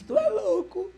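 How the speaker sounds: high, loud burst of disbelief. Retold or imitated. Driver Fábio reacts in shock to the preacher, loud and high. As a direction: blurt it out, shocked.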